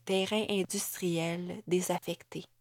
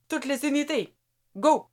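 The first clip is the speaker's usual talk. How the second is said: high, loud command; ordering while running; 6 semitones higher, 4 dB louder than usual. Acted. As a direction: launch the assault. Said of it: "ordonna Amélie en courant".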